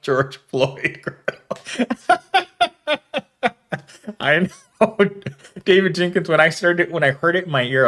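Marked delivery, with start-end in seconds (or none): 0.0-5.0 slow, laughing through the words; can barely get the name out for laughing; chuckling at a misheard name. Real, not acted. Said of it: "Laugh out loud".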